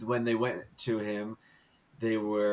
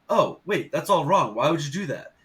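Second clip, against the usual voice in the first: higher, louder mock protest; retold surprised objection; 5 semitones higher, 6 dB louder than usual. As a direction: act out sudden, puzzled disagreement. Acts out Dalai Lama's surprised reaction.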